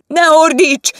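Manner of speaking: loud high shout. Acted. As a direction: shout her down. The quarrel. (hangos, magas kiáltás)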